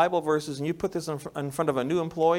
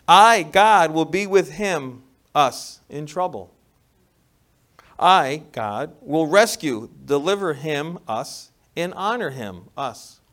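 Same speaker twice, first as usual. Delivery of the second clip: preaching full force; 3 semitones higher, 11 dB louder, slower than usual. Acted. Preaching scripture aloud.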